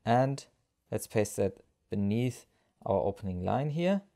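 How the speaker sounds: ordinary talk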